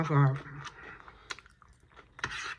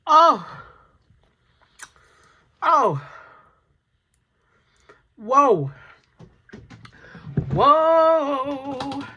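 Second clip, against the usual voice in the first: much higher, louder pained cries; 7 semitones higher, 8 dB louder, much slower than usual. Real, not acted. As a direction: cry out at the burn. Reacting to extreme spice heat.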